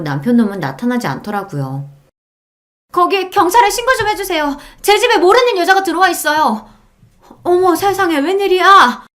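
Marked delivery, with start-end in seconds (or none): 2.9-6.7 urgent, high-pitched call; calling for help. A narrator's reading. Wife asking neighbours to call police on an intruder, urgent shout. 7.4-9.0 high-pitched, scandalised gasping words; shocked exclamation. A narrator's reading. Nosy neighbour seeing the commotion outside, shocked exclamation.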